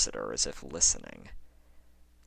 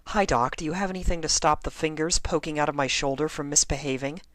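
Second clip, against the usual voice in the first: high, fast, chirpy pretend patient; sing-song as if asking the doctor; mock cheerful patient; 11 semitones higher, 4 dB louder, faster than usual. Lyra imagines asking doctor.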